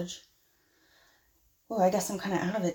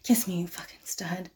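seductive whisper. Acted. Seducing the professor.

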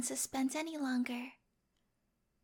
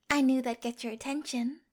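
ordinary talk, nothing marked

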